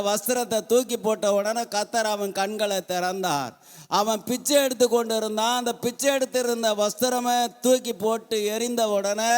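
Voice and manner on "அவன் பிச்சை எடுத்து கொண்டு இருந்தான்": ordinary delivery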